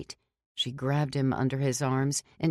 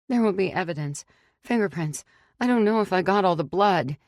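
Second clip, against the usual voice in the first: higher, fast, rising worry; fretting aloud about the evidence, anxious and fast; 5 semitones higher, 4 dB louder, faster than usual. A narrator's reading. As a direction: rattle it off, getting frantic.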